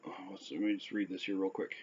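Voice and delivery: quick whispered aside to self. Real, not acted. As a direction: drop to a whisper, muttering to yourself. Aside before reading notes.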